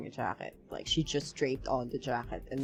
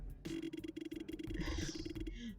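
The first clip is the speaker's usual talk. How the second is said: long sigh; 7 semitones higher, 14 dB quieter than usual. Real, not acted. Reacting to the story.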